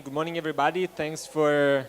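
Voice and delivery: usual speaking voice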